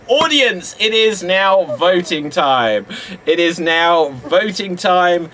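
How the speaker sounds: ordinary talk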